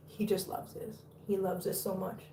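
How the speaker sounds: hushed, barely voiced fondness; tender murmur. Real, not acted. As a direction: speak softly over a cuddled kitten. Murmuring over cuddled kitten.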